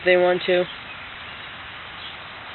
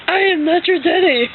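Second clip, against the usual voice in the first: high, hammed-up denial; dad as Santa; 10 semitones higher, 4 dB louder than usual. Dad insists to daughter he isn't daddy, mock Santa denial. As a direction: deny it in a raised put-on voice. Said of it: "He goes".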